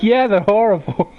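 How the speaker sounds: high Jersey guy verdict; imitated Jersey accent. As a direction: grouse like a Jersey husband. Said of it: "The hardcore Jersey accent".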